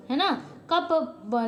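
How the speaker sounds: ordinary talk, nothing marked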